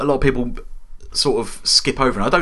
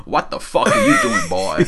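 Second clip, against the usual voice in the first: incredulous stare voice. Retold or imitated. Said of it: "looking at him going like".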